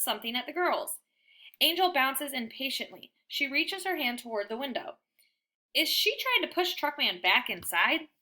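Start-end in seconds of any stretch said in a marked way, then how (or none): none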